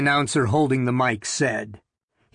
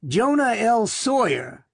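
a higher, lightly rasping thin man; 8 semitones higher than usual. A narrator's reading. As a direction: give the nervous thin man a rasp. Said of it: "the thin Negro said in a rasping voice".

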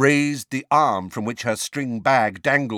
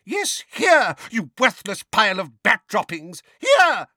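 very high, loud furious shriek; 12 semitones higher, 5 dB louder than usual. A narrator's reading. Neighbour berating Mundungus over Dementors, shrieking. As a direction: shriek the insult in outrage. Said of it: "shrieked Missus Figg".

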